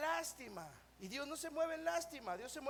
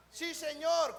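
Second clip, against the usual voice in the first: emphatic answer; 3 semitones higher, 7 dB louder, slower than usual. Acted. Blind men answer Jesus with faith.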